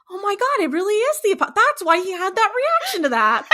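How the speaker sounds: high-pitched, laughing epiphany; mock sudden realization. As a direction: imitate someone putting it together in shock. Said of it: "she was sitting there thinking".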